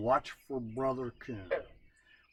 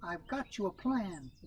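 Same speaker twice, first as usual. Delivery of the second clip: sly rabbit; 6 semitones higher, 5 dB quieter than usual. A narrator's reading. Rabbit announcing plan.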